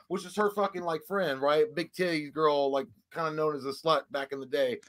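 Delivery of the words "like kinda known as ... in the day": normal talking voice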